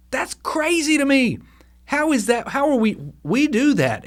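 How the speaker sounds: a genuine, wide-eyed disbelief; incredulous. Real, not acted. Preacher marveling at the hypocrisy.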